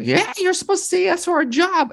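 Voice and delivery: high cartoonish voice imitating the callers; mock high corporate voice. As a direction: squeak it like a pushy caller. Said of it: "calls from Google being like".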